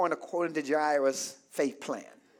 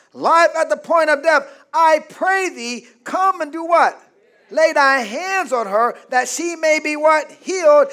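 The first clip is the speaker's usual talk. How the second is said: loud, raised pulpit voice building to shouts; higher, louder revival preaching; full-force preaching; 5 semitones higher, 5 dB louder than usual. Acted. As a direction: preach it hard to the crowd.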